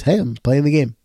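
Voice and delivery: ordinary delivery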